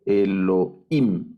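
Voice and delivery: Elohim drawn out, stress on final -im; acento en la última. Shown as a demonstration. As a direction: separate Elohim slowly and land on -him. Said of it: "aquí en esta sílaba aparece". (Elohim lento, con el acento en la última sílaba)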